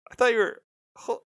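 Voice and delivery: high, startled double take; shocked disbelief. Retold or imitated. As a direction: blurt it out in shock. And you're like.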